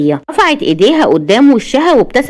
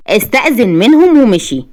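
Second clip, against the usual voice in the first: plain reading voice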